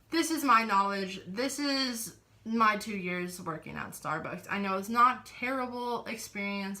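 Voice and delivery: ordinary talk, nothing marked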